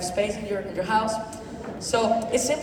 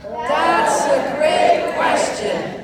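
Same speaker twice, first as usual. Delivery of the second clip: repeat-after-me demo; 3 semitones higher, 5 dB louder, much slower than usual. Shown as a demonstration. Coaching the room to say it properly, slow loud praise. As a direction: stretch it out big so the room joins in. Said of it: "How you say?".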